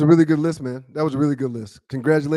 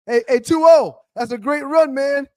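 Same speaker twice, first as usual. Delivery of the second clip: raised, higher voice hailing a teammate; calling out; 7 semitones higher, 4 dB louder than usual. Retold or imitated. Fan hailing Barry Sanders at the bench, calling out. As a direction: call out praise to the star player.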